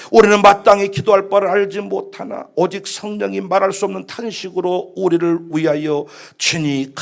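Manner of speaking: even, solemn recitation; scripture reading. Acted. Preacher reads Romans to the congregation, formal recitation. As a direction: read the verse out formally, drawing out the ending.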